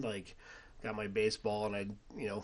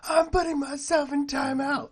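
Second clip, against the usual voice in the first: put-on very high, loud, laughing little boy voice; 11 dB louder than usual. Dad imitating his young son, very high and laughing. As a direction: squeak it out like a cute little boy. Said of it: "And he'd go".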